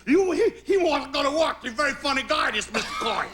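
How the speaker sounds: retelling how the doctor scoffs at going back to work, high mock accent; Chinese takeout cook impression. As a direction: play the stunned doctor in an exaggerated high accent, cracking up. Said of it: "began to talk like, you know, the, the cook in a Chinese takeout restaurant".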